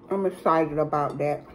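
eating between words; talking while chewing. Real, not acted. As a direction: mouth partly full. Seen 0: Puts food in mouth at 10.6, keeps talking while chewing after.

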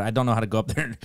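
ordinary talk

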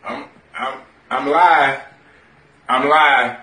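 ordinary talk, nothing marked

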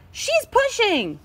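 high, drawn-out whine; tattling complaint. Acted. Scissors reports Pushpin pushing, whining.

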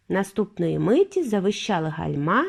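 ordinary delivery, nothing marked

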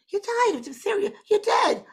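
very high mock astonishment; astonished Scottish lady. Spirit lady astonished at the little girl's answer, shrieky-high imitation. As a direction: play the lady shocked, pitch right up. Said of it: "a lady from Scotland".

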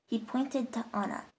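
ordinary delivery

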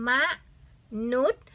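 มะ, long pause, นุษย์; careful split reading of มนุษย์ for learners; syllable-by-syllable reading. Shown as a demonstration. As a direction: sound out มนุษย์ as two separate beats. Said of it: "อันนี้อ่านว่า".

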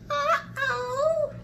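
very high worried squeak; alarmed mouse. Acted. Mouse spotting something in the wood, high alarm. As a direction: squeak it out in sudden worry.

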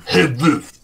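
dog offering a taste, mock tough voice. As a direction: play the dog daring someone, hammed-up. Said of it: "they're gonna be like".

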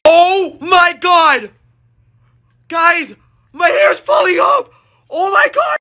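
slow wail breaking into a high cry; panicked wail. Acted. Telling viewers the hair is falling off, panicked wail. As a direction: cry out in horror.

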